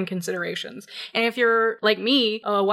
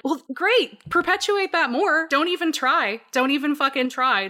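high sarcastic jab; mock the press with bitter sarcasm; 6 semitones higher than usual. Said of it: "And I'm like".

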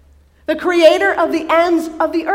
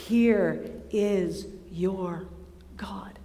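slow, lower, fading to a whisper; whispered awe; 5 semitones lower, 9 dB quieter, much slower than usual. Acted. Preacher repeating the revelation, whispered softly. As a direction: breathe it out in a whisper. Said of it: "repeated, fading to whisper".

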